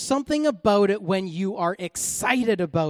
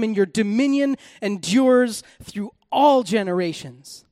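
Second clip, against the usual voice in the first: lightly raised, fervent psalm reading; scripture reading; 3 semitones higher, 3 dB louder than usual. Acted. Preacher reading Psalm 145 to the congregation, fervent. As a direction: read the psalm with lifted praise.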